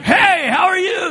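loud, high, drawn-out shouted hello; exuberant greeting. Retold or imitated. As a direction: jump out and holler a greeting. Bouncing out to greet a stranger.

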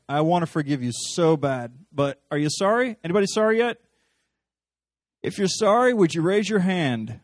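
sarcastic loud bargaining; mocking demanding Jesus. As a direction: ham up an impatient Jesus begging for apologies. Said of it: "I'm not mocking you".

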